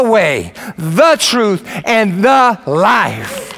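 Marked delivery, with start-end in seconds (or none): 1.8-3.5 preaching shout. Real, not acted.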